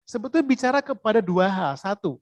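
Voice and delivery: normal talking voice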